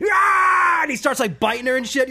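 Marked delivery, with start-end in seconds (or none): 0.1-0.8 doll's attack scream. Retold or imitated. Reenacting Chucky's attack.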